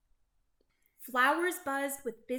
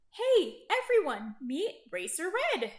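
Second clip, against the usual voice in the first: high, loud hail easing off; calling to friends; 5 semitones higher, 4 dB louder than usual. A narrator's reading. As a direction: hail the group and introduce a friend. Truck calls out to his friends.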